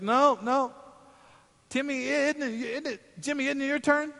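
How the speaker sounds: put-on high, pushy little girl voice; whiny sister. Sister to Grandma, dodging dishes, high whiny little girl. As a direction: shove the chore onto him in a high whine. Sister shifts chore onto brother.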